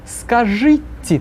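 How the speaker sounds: slow, drawn-out model word; slow repeat-after-me. Shown as a demonstration. Teacher counts the class in to repeat the word. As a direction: stretch it out, then cue the class. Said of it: "три-четыре".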